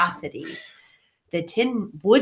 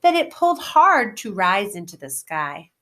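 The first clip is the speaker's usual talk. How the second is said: plain reading voice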